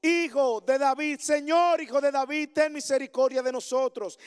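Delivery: high, loud plea to Jesus; a blind man's loud, high plea for mercy; crying out. Acted. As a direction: call out loud and pleading, reaching upward. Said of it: "gritaron diciendo".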